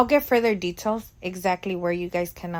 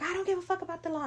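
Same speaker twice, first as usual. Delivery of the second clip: mock furious caller; 12 semitones higher, 4 dB quieter, much faster than usual. Angry caller hangs up.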